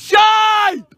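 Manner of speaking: long, high drawn-out call; real coach's holler; touchline shout. Real, not acted. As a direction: shout it across the pitch. Coach calling to players on pitch.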